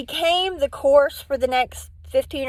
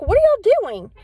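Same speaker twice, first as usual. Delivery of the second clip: loud, much higher demand; alarmed shout; 9 semitones higher, 7 dB louder than usual. Retold or imitated. Husband comes out and shouts at the police, loud and alarmed. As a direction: burst out of the house shouting.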